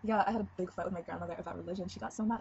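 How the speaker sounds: ordinary delivery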